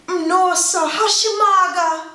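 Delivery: react, taken aback, to how thin she looks; dismayed concern. Acted. Seeing someone who looks sick, dismayed concern. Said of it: "they just look sick, you'll be like".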